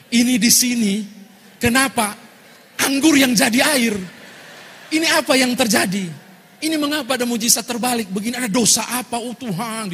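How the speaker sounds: loud, high outcry to God; hammed-up wail of bewilderment, shouted high then easing off; anguished wrestling. Pastor asking God why wine became water, anguished shout. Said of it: "pendeta bergumul luar biasa".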